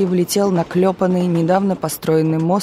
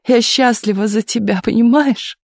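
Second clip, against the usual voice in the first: higher, tearful, overflowing joy; happy tears breaking through; crying for joy; tearful happiness; 5 semitones higher than usual. A narrator's reading. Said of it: "всхлипывала мать".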